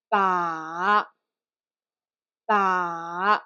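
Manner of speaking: each "Ba" sinks low, then lifts; low V-shaped pitch on "Ba"; third tone. Shown as a demonstration. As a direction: draw out "Ba" downward, quick rise at the end. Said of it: "quickly go up a little bit".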